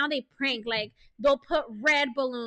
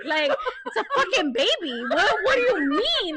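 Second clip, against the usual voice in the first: high, loud bafflement with laughter in it; exasperated disbelief; 5 semitones higher, 3 dB louder than usual. Retold or imitated. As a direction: act out incredulous exasperation, half laughing. Exasperated at the husband's question.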